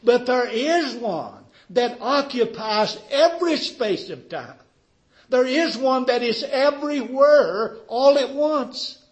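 loud, high, ringing proclamation; full-force preaching. Real, not acted. Preacher proclaiming the One who is everywhere, full-force preaching. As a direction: declare it boldly to the whole room.

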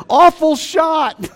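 high, loud and drawn out; slow mock yell. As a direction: call it out long and loud in dismay. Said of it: "why golfers just don't say".

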